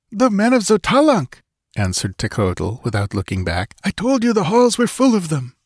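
high, tense voice of the guide; frightened guide Tekodl. A narrator's reading. Tekodl answers Conan without looking back, high and tense. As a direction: pitch up high for the nervous guide.

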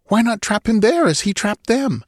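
put-on high, eager young nobleman pressing a plan; eager urging. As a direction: push the idea, pitched high and keen. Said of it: "exclaimed Mirlo".

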